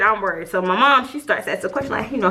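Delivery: usual speaking voice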